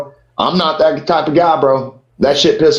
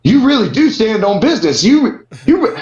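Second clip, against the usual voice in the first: much higher, gushing fan voice; starstruck fan; 10 semitones higher than usual. Retold or imitated. Fan from Kill Tony gushing at the comedian. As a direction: gush like an amazed fan. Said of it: "He's like".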